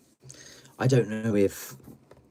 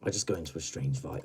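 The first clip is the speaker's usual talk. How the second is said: normal talking voice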